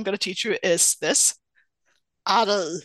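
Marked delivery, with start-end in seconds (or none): 2.3-2.8 guttural southern Swedish diphthong; southern Swedish accent. Shown as a demonstration. Teaching podcast host a southern expression, guttural southern Swedish accent. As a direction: say it in thick Scanian. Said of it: "bit more guttural than the Swedish accent".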